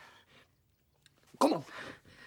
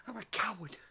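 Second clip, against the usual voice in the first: unvoiced bitter whisper; 3 semitones lower, 10 dB quieter than usual. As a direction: drop to a whisper. Ranting, sudden drop.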